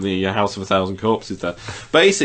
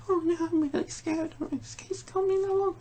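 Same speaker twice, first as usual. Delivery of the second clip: much higher, softer frightened lady voice; retelling the lady character afraid of the man; scared lady voice; 8 dB quieter than usual. Retold or imitated. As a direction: squeak it out like a scared old lady. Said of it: "the lady character within this fella's like".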